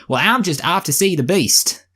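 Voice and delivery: high-pitched mock American heroine; American accent. Belle answering a villager, in a put-on American accent. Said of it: "the main characters all speak with American accents".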